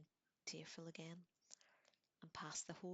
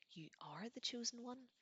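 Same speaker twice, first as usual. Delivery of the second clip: astonished; 7 semitones higher than usual. A narrator's reading. Slughorn stunned by Harry's claim.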